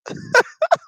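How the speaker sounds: very high squeaky laugh; squealing laugh. Real, not acted. Laughs at own joke.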